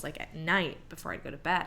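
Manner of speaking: ordinary delivery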